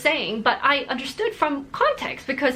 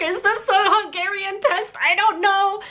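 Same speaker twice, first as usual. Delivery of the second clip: higher, panicky protest; flustered high voice; 8 semitones higher than usual. Retold or imitated. Answering the yelling toilet lady, high and flustered. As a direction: squeak it out, caught off guard.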